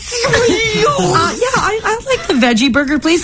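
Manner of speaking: high, strained, slow falsetto; squeaky falsetto mockery. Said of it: "making me sound like a constipated Bill Cosby".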